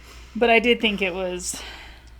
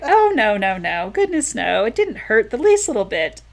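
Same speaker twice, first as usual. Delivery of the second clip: falsely polite; 3 semitones higher, 5 dB louder than usual. Acted. Woman lying politely to her clumsy partner, sugary denial. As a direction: brush it off too sweetly. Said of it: "she says out loud".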